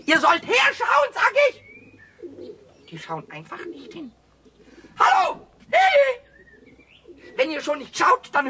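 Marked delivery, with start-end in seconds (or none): 0.1-1.6 laut und höher, ungeduldig rufen; ungeduldiger Ruf. Acted. Pumuckl ruft die Tauben ungeduldig. 5.0-6.2 sehr hoch, laut und langsam gerufen; gedehnter lauter Ruf. Acted. Pumuckl ruft die Tauben.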